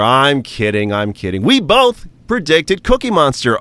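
normal talking voice

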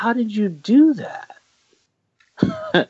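very high, drawn-out disbelief; acted amazement. Film fan marvelling at a director's debut, high-pitched amazement. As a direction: squeal it slowly, astounded.

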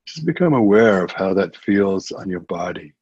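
ordinary talk

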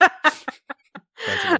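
high laughing voice; words bursting with laughter. Real, not acted. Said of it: "laughing while talking".

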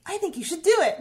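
loud and high, pushing her to do it; emphatic urging. Retold or imitated. Friend insists loudly that she should post. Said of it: "and she was like".

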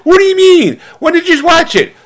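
high, accusing mock wife; mock indignant wife. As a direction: shoot it back like a betrayed spouse.

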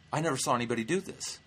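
normal talking voice

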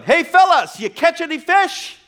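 high, loud call across the water; yelling out. Retold or imitated. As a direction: call out to men in a distant boat. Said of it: "he yells out to them".

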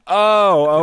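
loud, high, drawn-out dawning; sudden realization. Retold or imitated. Actor finally getting the director's note, sudden realization. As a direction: burst out as it clicks.

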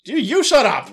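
high, loud, flustered retort; wigging out. Acted. Doctor yelling back at the butler as he wigs out, flustered shout. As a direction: shout back, rattled, half laughing. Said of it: "wigging up".

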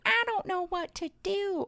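high, helpless quoted plea. Retold or imitated. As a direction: whine it helplessly, way up. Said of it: "they'd be like".

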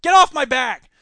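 loud, exasperated snap; annoyed outburst. Acted. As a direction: bark it at the pest who won't stop. Snapping at a nagging interrupter.

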